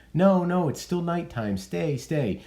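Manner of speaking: high, pleading Juliet voice. Retold or imitated. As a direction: beg him to stay, high and urgent. Voicing Juliet begging Romeo stay.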